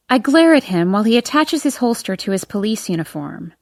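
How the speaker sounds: ordinary delivery, nothing marked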